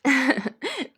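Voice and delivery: put-on high giggly child voice; laughing, coaxing little girl. A narrator's reading. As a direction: giggle through a playful apology. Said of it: "وضحكت تيا".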